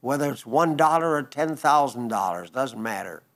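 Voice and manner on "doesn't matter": ordinary talk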